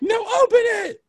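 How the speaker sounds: high, loud shout from a distance; yelling across the driveway. Retold or imitated. Friends yell at Isaiah from the car, shouting.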